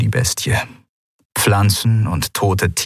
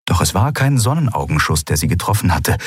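usual speaking voice

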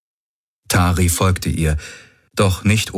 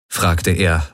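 ordinary delivery

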